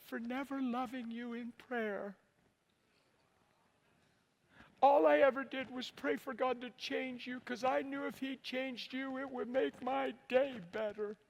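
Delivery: high, drawn-out emotional plea, imitating his wife; tearful wife. Wife confessing to her husband how she prayed, tearful. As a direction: act out a wife's slow, high-pitched, heartfelt confession. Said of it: "she's crying".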